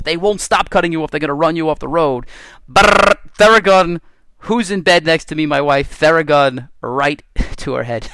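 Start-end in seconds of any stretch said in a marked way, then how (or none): 2.7-4.0 buzzing noise loud. Shown as a demonstration. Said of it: "full blast". 6.0-8.1 slow, laughing through words. Real, not acted. Laughing at own story.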